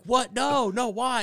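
high, loud pleading what, no, why; loud retold dismay; mock alarm. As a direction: protest in hammed-up disbelief.

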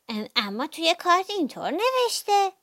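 high-pitched mouse voice, opening with "um"; hesitant little mouse. A narrator's reading. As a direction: object timidly as a small high-voiced mouse. Mouse hesitantly contradicts bear. (صدای زیر و مردد موش)

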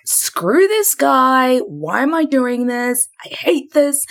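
high, drawn-out exasperated griping; mock cursing. Girlfriend cursing boyfriend on a steep climb, mock cursing. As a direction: stretch the words out in a high comic whine of complaint. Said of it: "cursing and being like".

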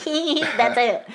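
high-pitched mock snicker. Couple sneaking past the chapel, imitated high snickering. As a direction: act out a sly, high giggle.

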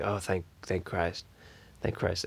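low, soft, slow relieved sigh; mock relief. Everyone relieved about the pajama pants, low and slow. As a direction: sink into exaggerated relief. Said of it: "everyone was like".

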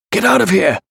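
high, shouted order to flee; urgent shout. A narrator's reading. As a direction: yell at friends to escape. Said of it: "he shouted to the others".

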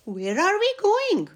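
put-on high childlike voice. A narrator's reading. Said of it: "himself asked".

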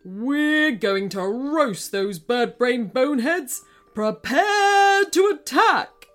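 battle command. Acted. King Krusty orders attack.

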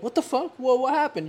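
high, loud mock outrage; startled by the price jump; mock shocked customer. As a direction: squawk at the new price.

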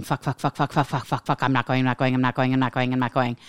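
rapid monotone refrain, words run together; mock obstinate muttered mantra; retold stubborn looping. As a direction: keep repeating it like a stuck record. Retold walking around refusing, repeating.

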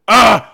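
loud, exasperated ugh; frustrated outburst; angry groan. Acted. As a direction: let out a loud groan of anger. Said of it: "I was so fucking angry".

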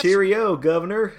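cartoon British accent as a wrestling gag; mock British accent. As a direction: toss off a joke British Cheerio. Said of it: "he tried a British accent".

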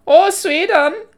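very high, loud put-on Swedish voice; hammed-up high Nordic squeal; high-pitched mock Swedish. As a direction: pitch it way up like a cartoon Scandinavian. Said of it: "that high pitch".

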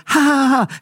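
high mock audience "ha ha ha"; imitated crowd laugh. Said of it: "the crowd's like".

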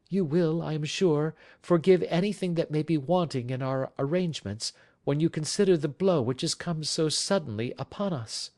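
high, gracious woman's voice; wife warmly greeting Watson; warm welcome. Acted. Wife character continues.

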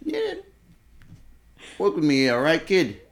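lightly put-on Italian-American tough talk; Italian accent. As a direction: coax in a mock Italian accent. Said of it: "my Italian accent".

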